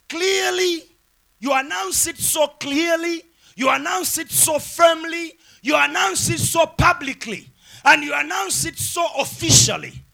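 high, insistent preacher's list; emphatic preaching. Real, not acted. Preacher explains what declaring means, high emphatic preaching.

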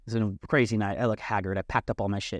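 ordinary delivery, nothing marked

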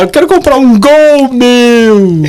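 slow, drawn-out high buyer. Retold or imitated. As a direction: drag the words out slowly, high. Quoting a buyer.

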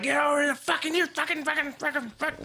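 high-pitched mock rage, sputtering curses; hammed-up road-rage shouting; angry driver. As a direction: play the furious driver stuck behind you. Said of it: "And he's like".

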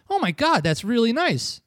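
high, delighted retold reaction; touched surprise.

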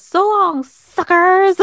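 high, loud, gleeful farewell shout; hammed-up movie getaway yell; triumphant taunt. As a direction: crow it out as a parting jab. Acting Carl's triumphant farewell.

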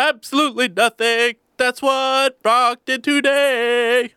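high, loud and very slow exaggerated tease; mock dramatic. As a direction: drag it out like a grand accusation. Teasing a friend about his day.

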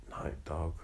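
Night Dog said softly, dropping away; shy boy almost whispering his hero name; almost whispered. A narrator's reading. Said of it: "almost in a whisper said".

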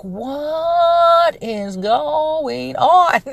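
high, very slow, drawn-out disbelief; exasperated bewilderment. Retold or imitated. Mom baffled by her crying kids, drawn-out exasperation. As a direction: stretch it out like you're at your wit's end.

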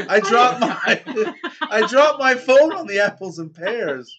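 slow, high, loud put-on voice; mock dismay. Player pretends to drop a phone on stairs, mock dismay. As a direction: drag it out loudly, half laughing.

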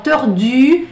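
tordu with rounded lips, i said with a u mouth; French u in tordu. Shown as a demonstration. Teacher isolating the French u sound. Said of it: "o sonzinho de U".

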